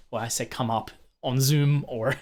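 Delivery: ordinary talk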